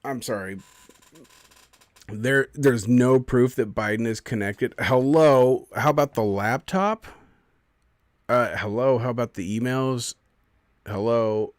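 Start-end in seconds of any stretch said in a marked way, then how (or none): 4.8-11.5 sarcastic hello. Real, not acted. Mocking the fact check.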